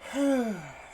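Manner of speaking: long, high exhale; acted puff to catch breath. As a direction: blow out a winded breath. Child pauses to catch breath.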